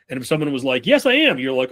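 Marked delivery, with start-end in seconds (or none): 0.9-1.4 high, blurted cheery reply; mock eager answer. Drinking-game player answering with a statement by mistake, high mock eagerness. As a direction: pipe up, pitch jumping way up.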